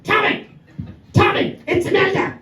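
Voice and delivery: balcony women calling down to the singer, shrieking; women shouting. Retold or imitated. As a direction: shriek from the balcony to get his attention. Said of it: "four women shouting".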